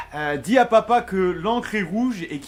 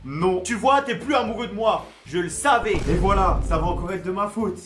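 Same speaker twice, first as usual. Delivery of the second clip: ordinary delivery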